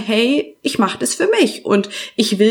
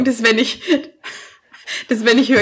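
laughing fit; faster than usual. Real, not acted. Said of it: "krieg ich einen Lachkrampf".

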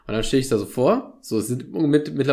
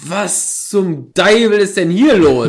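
slow, higher and louder shocked outcry; 6 semitones higher, 8 dB louder, much slower than usual. Retold or imitated. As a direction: wake up and look around in alarm. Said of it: "so ein Schockmoment".

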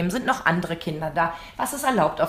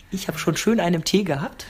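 ordinary talk, nothing marked

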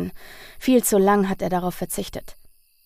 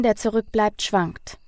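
plain reading voice

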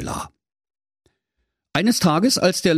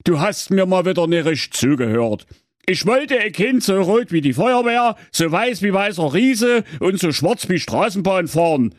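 higher Saxon husband grumbling; 7 semitones higher than usual. Acted. Husband Werner grumbling about the baby, husband voice. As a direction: complain he wasn't listened to. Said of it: "sprach der Werner".

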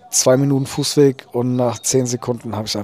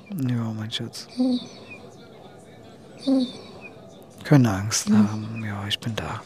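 soothing whisper; 6 dB quieter than usual. Real, not acted. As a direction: whisper reassurance to a scared pet. Whispering comfort to the dying dog.

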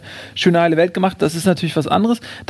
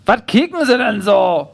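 higher, slow Berlin-dialect challenge; put-on touchy busybody, drawn out; nosy neighbour; 4 semitones higher, slower than usual. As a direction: demand an answer in slow, raised dialect. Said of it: "fragt sie".

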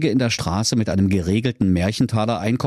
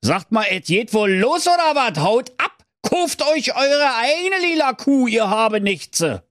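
angry Kaiser voice; 13 semitones higher than usual. Acted. Kaiser schimpft die Kinder an.

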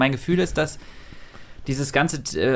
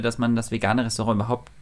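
ordinary talk, nothing marked

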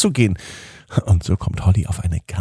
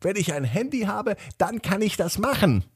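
put-on higher girl's voice, eager; excited little girl; 12 semitones higher, 5 dB quieter than usual. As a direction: voice Holly eagerly asking. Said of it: "Holly sagte zu Mama und Papa". (aufgeregtes kleines Mädchen)

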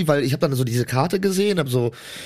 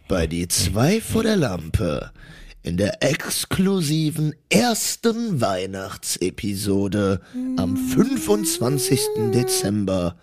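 slow show host welcome; 4 semitones lower, much slower than usual. Acted.

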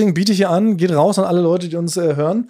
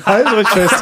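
clickbait video narrator; 4 semitones higher than usual. Pretend. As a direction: pump up the crowd, laughing. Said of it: "Dann können wir sagen".

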